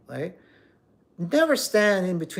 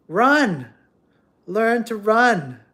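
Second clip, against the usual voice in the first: emphatic shout; 4 semitones higher, 7 dB louder, much slower than usual. Real, not acted. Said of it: "stressed advice".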